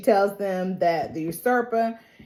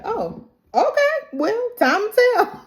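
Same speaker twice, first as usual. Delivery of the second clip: much higher, sing-song knowing voice; knowing mock reply; 12 semitones higher than usual. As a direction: lilt it up like you know better. Allison's knowing reply, retold.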